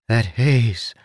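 ordinary delivery

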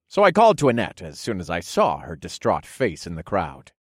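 ordinary delivery

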